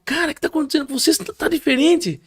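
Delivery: high, quick astonished question; quick, high-pitched retold amazement; amazed friend.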